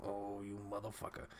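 very low-volume mock cursing under the breath; hushed, put-on grumble of resentment; retold seething anger. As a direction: imitate a mad mom grumbling to herself. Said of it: "like, mad".

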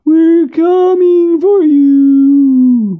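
very high, loud, drawn-out spectral howl; raspy ghostly howl. A narrator's reading. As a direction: howl it slowly like a vengeful spirit. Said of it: "with a raspy howl".

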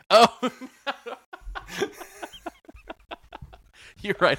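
high breathy laugh bursting out at the hat; burst of surprised laughter. Real, not acted. Host laughing at guest's Cowboys straw hat, surprised laughter. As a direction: crack up at the sight of the hat.

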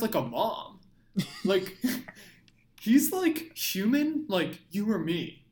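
hammed-up earnest fan, close to cracking up; mock superfan. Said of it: "They're like, you know".

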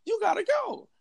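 high, fast mock bouncer scolding; bouncer kicking him out. Bouncer telling the drunk guy to leave, high mock scolding. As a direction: play the exasperated bouncer up high. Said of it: "the guy was like".

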